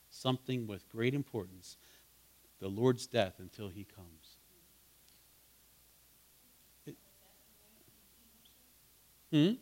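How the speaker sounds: ordinary delivery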